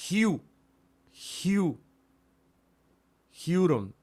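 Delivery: hu, hu, then huron, slowly assembled; building up huron. Shown as a demonstration. Teacher sounding out the word in syllables for students. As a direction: break it into hu, then say huron whole. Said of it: "entonces esto es".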